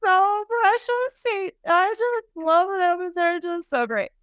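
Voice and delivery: high, tearful mock gush; high-pitched, over-the-top sentimental husband; imitating gushing husband. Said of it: "He's just like".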